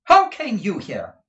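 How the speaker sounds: loud, high-pitched surprised question; startled demand. A narrator's reading. Madame discovering Kate unexpectedly, loud startled question. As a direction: burst out high, falling at the end. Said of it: "recoiling in surprise".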